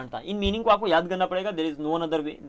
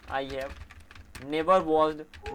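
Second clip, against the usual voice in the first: slow dictation; much slower than usual. Shown as a demonstration. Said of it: "teacher repeats sentence for students".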